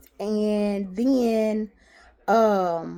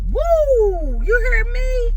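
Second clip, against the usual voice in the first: joyful whoop; 13 semitones higher, much slower than usual. Real, not acted. Woman on ultrasound table cheering to God. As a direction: shout it out in relief and joy. Praising God after good news.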